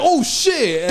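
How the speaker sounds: high, loud jolt of surprise; retold startled outburst; imitated startled shock. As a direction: wake up shocked, acted. Imitates partner waking in shock.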